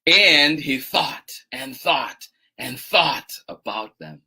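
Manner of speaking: storytelling with drawn-out phrase endings; expressive story reading with long pauses; picture-book narration. A narrator's reading. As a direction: read slowly to little children, savouring each step. Reads picture book aloud.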